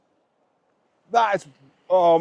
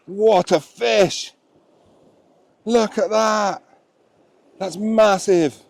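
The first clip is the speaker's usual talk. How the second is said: ordinary talk, nothing marked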